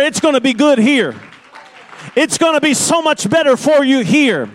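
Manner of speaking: loud, high-pitched revival preaching; full-force preaching. Real, not acted. Preacher proclaiming everlasting life to the church, loud and impassioned. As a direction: drive it home to the congregation at full volume.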